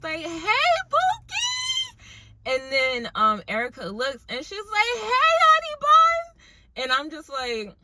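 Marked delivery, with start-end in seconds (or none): 0.2-2.0 high, drawn-out mock holler; yelling. Waitress yelling a nickname across to Erica, high drawn-out yell. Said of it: "and then someone yells". 5.0-6.4 loud, very high sing-out holler; yelling back. Retold or imitated. Erica yelling back to the waitress, loud high holler. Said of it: "yelling back and forth with Erica".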